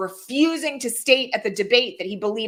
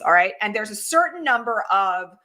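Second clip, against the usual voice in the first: normal talking voice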